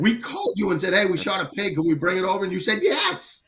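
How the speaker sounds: high-pitched, pleading mock outrage; indignant protest. Hunter reminding the butcher he agreed to it, indignant. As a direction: retell the argument, slowing on the final word.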